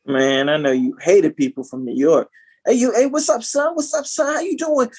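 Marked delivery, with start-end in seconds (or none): 2.7-4.9 very high, rapid-fire teasing greeting; mock New Yorker. Teasing a friend who hated people from New York, mock greeting. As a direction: pester him in a hammed-up, high New York voice.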